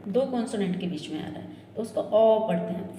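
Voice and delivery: usual speaking voice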